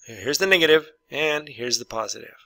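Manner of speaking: usual speaking voice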